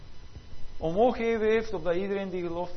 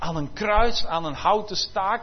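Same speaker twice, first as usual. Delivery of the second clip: loud preaching; 5 dB louder than usual. Acted. Jesus quoted, preached loudly.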